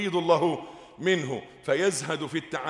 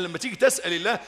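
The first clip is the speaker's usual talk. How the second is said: ordinary talk, nothing marked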